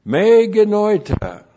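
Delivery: loud, high proclamation of the Greek; forceful emphatic Greek negative. Shown as a demonstration. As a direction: declare it as the strongest no. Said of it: "the strongest negative in the Greek".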